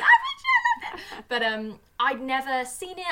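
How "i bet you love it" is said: high, loud mock outrage; mock indignant emailer. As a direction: shout it like an offended fan.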